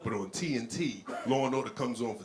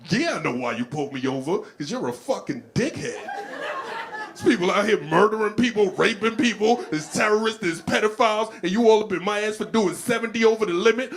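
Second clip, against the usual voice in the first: loud, high outraged driver; indignant rant; 9 semitones higher, 8 dB louder than usual. Pretend. Speeding driver tells off the cop, loud mounting outrage. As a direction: build from retort to shouted tirade.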